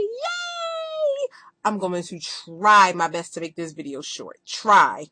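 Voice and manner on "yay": excited cheer. Real, not acted. Celebrating her surgery anniversary.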